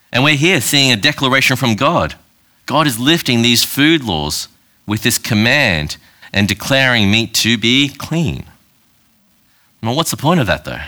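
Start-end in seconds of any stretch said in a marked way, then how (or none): 2.7-8.4 slow emphasis. Real, not acted. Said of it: "slowed for emphasis".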